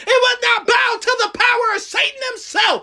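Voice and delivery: shouted high preaching climax; roaring rallying speech. Acted. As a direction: roar it out like a revival. Climax of sermon, shouting declarations.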